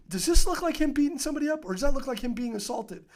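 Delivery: loud, high imitation of a rebuke; retold indignant scolding. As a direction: act out a sergeant confronting a rookie. Sergeant berates cop with video.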